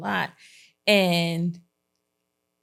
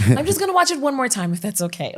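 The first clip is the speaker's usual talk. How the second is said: quick, higher child imitation with a laugh; imitated proper daughter; 6 semitones higher, faster than usual. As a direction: mimic a polite child, fast and high. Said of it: "She's real proud".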